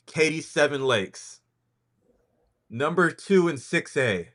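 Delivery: rankings announcer. Acted. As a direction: announce it like a big reveal, pausing for suspense. Host counts down the rankings.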